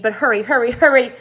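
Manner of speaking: building, rush him on; hurrying. Acted. Centipede rushes James.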